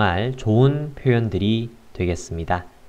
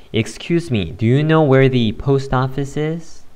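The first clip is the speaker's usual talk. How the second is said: slow model sentence; 3 semitones higher, much slower than usual. Shown as a demonstration. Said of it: "첫 번째 문장은".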